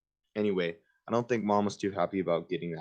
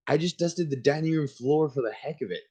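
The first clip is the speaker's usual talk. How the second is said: put-on eager kid; 8 semitones higher than usual. Kid sucking up to mom.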